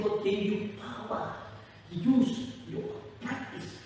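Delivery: usual speaking voice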